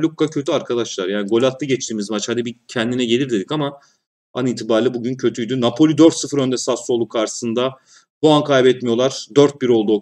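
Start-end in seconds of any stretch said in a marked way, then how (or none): none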